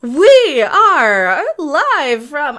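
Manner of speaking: big, slow, loud announcer opening; show intro. Pretend. Host trying an intro for the new channel, booming announcer. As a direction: draw it out like a live-show host. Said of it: "a good intro for the new channel".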